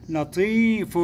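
لطيف drawn out, ending in -un; tanween damm. Shown as a demonstration. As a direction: stretch لطيف slowly into a clear -un. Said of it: "تنوين ضم".

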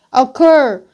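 Occur punched loud on CUR; light first syllable in "Occur", strong second; second-syllable stress. Shown as a demonstration. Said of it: "sẽ rơi vào âm tiết thứ hai".

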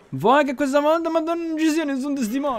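high-pitched sarcastic wondering; mock disbelief. Gamer wondering about witnesses, in high mock disbelief. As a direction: raise the pitch in exaggerated doubt.

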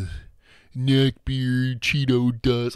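ordinary talk, nothing marked

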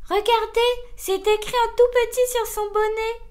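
high, loud, delighted call; radiant delight. A narrator's reading. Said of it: "la limace radieuse".

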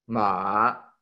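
MA dipping low, then climbing; falling-rising tone. Shown as a demonstration. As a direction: drop MA down and swing it back up. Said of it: "baja un poquito y sube".

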